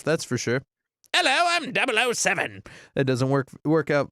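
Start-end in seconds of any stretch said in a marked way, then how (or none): 1.1-2.6 higher-pitched, badly faked British spy; mock British agent introducing himself; attempted British accent. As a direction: have a go at a British Bond. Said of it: "I definitely couldn't pull it off".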